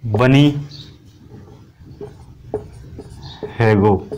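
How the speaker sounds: word by word with long pauses; spaced-out classroom dictation. Shown as a demonstration. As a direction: dictate each word separately for note-taking. Dictating the mnemonic as students write.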